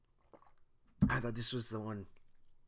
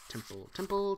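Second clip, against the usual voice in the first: higher, louder test words; mic check, higher; sound check; 7 semitones higher, 7 dB louder than usual. Shown as a demonstration. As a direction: call out the word to test the mic. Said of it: "One more".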